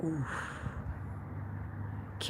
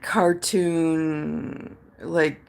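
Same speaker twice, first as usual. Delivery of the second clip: usual speaking voice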